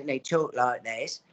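a lightly voiced British accent. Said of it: "Bob had an accent".

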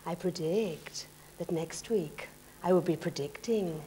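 very slow, portentous fortune-teller; Mystic Meg. Acted. Psychic solemnly making an empty prediction, slow mock-mystical. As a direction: intone each word with mock mystery. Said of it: "I think she'd probably say".

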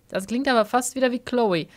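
ordinary talk

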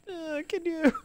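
high, soft, meek little voice; timid mock plea. Too nervous to ask wrestlers for shout-outs, timid mock squeak. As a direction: squeak out a nervous request, hammed-up. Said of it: "I'm like, I'm a little bitch".

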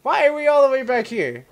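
high, loud cry of dismay; dismayed wail. Real, not acted. Gamer sent all the way back, dismayed wail. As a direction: wail at losing all the progress.

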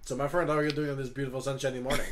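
put-on brisk, jovial French-accented greeting; [name] in character, cheery. [name] retries his morning greeting, brisk French accent. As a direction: rattle off a sunny hello as a Frenchman.

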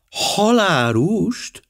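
"Halárust" drawn out slowly on a high rising question; slow astonished high echo of "Halárust"; surprised. Acted. Miss Limon hearing Poirot's odd errand, surprised. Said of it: "kérdezte Miss Limon meglepődve".

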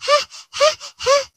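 witch cackle. Acted.

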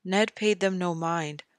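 usual speaking voice

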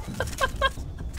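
panicked, very high wheezing laughter; high breathless laugh. Real, not acted. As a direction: laugh helplessly after a fright. Laughing after the scare.

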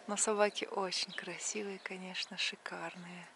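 hushed aside, voice dropped to a whisper. Real, not acted. Aside whispered about dogs.

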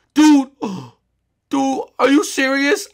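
high, loud gasping disbelief; shocked gasp. Acted. Friend seeing the body through the garage, gasping high and loud. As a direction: gasp and yelp it out. Friend gasps at horrible sight.

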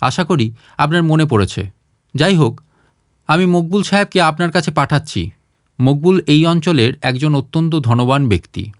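plain reading voice

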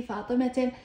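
فاطمة said fatimate, final t plus short vowel; voweled t ending. Shown as a demonstration. Teacher showing the spoken t, فاطمة ending in te. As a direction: end فاطمة on a clear te. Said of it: "هنا لفظتها تاء".

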